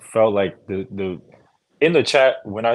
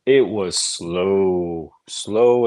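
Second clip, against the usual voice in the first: drawn-out slow; much slower than usual. Acted. Complaining the first half dragged.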